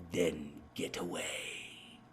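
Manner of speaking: low, soft, slow menacing ghost; the other voice. Retold or imitated. Voicing the evil presence from his recording, low and slow. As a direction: drag it out low like something you never want to capture. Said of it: "then you hear the other voice go".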